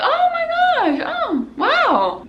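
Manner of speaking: high, drawn-out mock wow; half-hearted amazement. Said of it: "like, half-hearted hugs".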